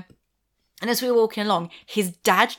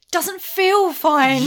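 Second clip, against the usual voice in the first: sarcastic thought; 6 semitones higher, much slower than usual. Retold or imitated. Thinking to herself on the date, slow and wry. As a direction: drag it out, unconvinced. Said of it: "And I'm thinking".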